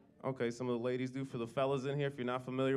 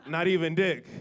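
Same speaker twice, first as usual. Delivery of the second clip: defiant mom; 4 semitones higher, 5 dB louder than usual. Retold or imitated. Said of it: "she was like".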